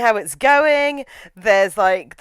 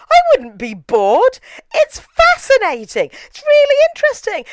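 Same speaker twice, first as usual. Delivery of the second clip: high, emphatic and loud fascination; indignant enthusiasm; 13 semitones higher, 5 dB louder than usual. Real, not acted. Film fan defending the torture lecture, high indignant enthusiasm. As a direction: insist on it, voice shooting up.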